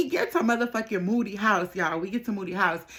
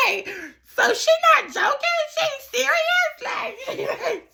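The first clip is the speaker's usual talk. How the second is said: shrieking with laughter; slower than usual. Retold or imitated. To her friend, realising they share the same man, shrieking with laughter. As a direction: crack up in disbelief as you ask.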